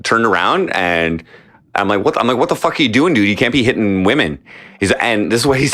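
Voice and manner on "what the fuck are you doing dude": normal talking voice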